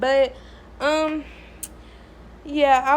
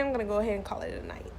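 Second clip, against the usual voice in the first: whispering; 4 semitones lower, 8 dB quieter, much faster than usual. Real, not acted. Signing off for the night.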